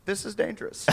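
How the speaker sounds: quiet. Retold or imitated. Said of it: "I'm like".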